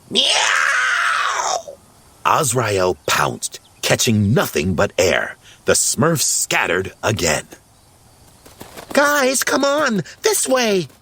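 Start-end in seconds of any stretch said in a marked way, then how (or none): 0.1-1.8 long, high yowling meow; loud cat meow. A narrator's reading. Azrael interrupts the Smurfs, meowing loudly. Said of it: "interrupted by a loud". 8.9-10.9 very high, urgent character call. A narrator's reading. Smurfette leads the scattered Smurfs, calling out. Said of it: "Smurfette called out".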